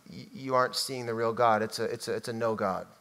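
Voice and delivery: usual speaking voice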